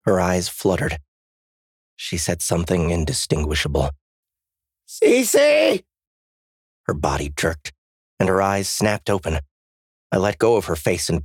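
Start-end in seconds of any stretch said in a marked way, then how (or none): 4.9-5.8 high, loud call of a name; urgent shout. A narrator's reading. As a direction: cry out to rouse someone slipping away. Cries out to rouse her.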